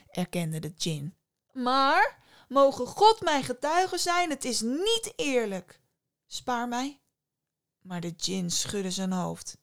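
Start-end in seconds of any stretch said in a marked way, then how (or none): 1.5-7.0 higher, louder plea to be spared; begging fisherman, pitched up; fisherman pleading. A narrator's reading. (smekende vissersstem, hoger)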